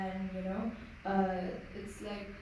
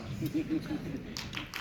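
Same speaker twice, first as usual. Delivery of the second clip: short breathy embarrassed laugh; nervous laugh; 8 semitones higher than usual. Real, not acted. Shy actor ending her answer to the audience, nervous laugh. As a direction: laugh off the nerves after finishing.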